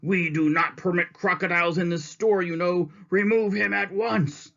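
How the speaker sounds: indignant manager. Acted. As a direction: order the crocodile out. Mr. Grumps orders removal.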